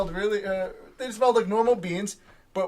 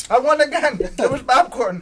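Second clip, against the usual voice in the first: higher, louder happy win; excited; 5 semitones higher, 6 dB louder than usual. Real, not acted. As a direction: celebrate the lucky bean. Winning the bean game.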